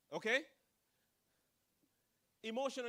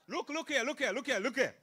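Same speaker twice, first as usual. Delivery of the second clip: louder and louder look here; loud repeated call; 4 semitones higher, 9 dB louder than usual. Shown as a demonstration. Speaker calling the audience to look, loud. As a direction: call them to look, building. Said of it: "Let me give you an example".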